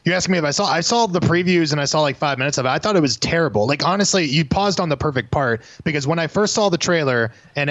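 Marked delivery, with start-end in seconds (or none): none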